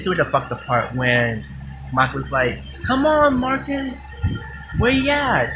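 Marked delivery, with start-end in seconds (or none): 2.8-5.5 very high-pitched retold call to a partner; imitated calling out. Acting out Mike calling for Marcus.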